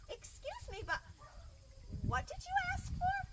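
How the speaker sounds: high, polite question climbing upward; puzzled librarian. Acted. Librarian asks the goat to repeat, puzzled. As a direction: ask in a high, baffled voice. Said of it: "very friendly children's librarian looked".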